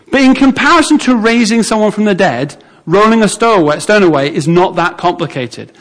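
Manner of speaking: normal talking voice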